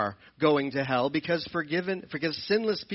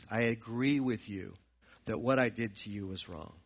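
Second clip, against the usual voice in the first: much softer, quick humble apology; 7 semitones lower, 7 dB quieter than usual. Retold or imitated. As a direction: apologize quietly, head down. Someone returning to apologize.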